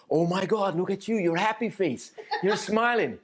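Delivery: high, gushing talk to a dog; squeaky baby talk. Acted. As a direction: squeal fondly at your dog. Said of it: "squeak like a little puppy".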